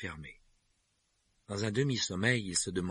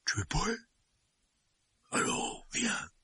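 whispering; 10 semitones higher, slower than usual. A narrator's reading. Hagrid whispers to Harry.

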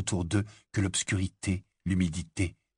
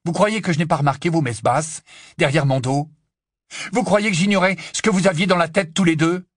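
loud, raised-pitch reproach; angry accusation; 7 semitones higher, 6 dB louder than usual. Acted. Ron accuses his friends of whispering behind his back, angry. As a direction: press each accusation forcefully.

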